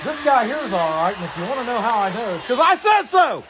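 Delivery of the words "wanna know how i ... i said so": high, loud 'because I said so' voice; hammed-up authority voice; mock bossy parent. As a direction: play a bossy parent laying down the law. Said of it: "No, I'm just kidding".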